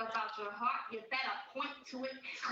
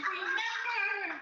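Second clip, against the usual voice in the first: slow, loud, higher shout of the word; hammed-up dramatic exclamation; sudden loud cry; 8 semitones higher, 7 dB louder, much slower than usual. Shown as a demonstration. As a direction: bellow it for contrast.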